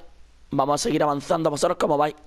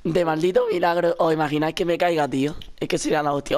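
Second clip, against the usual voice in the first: ordinary talk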